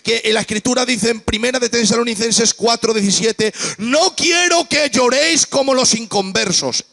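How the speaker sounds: full-voiced Pentecostal climax; fiery preaching. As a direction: cry it out to the congregation.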